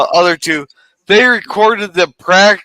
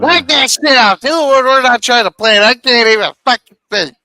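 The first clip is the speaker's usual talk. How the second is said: mildly angry, higher-pitched telling-off; irritated father; 5 semitones higher than usual. Acted. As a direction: snap like a dad who can't think. Acting out an irritated yelling father.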